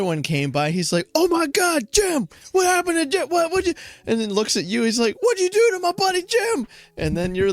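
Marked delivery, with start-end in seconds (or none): 1.1-3.7 high, loud comic shriek of horror; mock-distraught wasp voice, shouted high; panicked wasp. Voicing a horrified second wasp. 5.2-6.7 very high, loud mock accusation; hammed-up squeaky outrage; outraged wasp. Wasp confronting the killer.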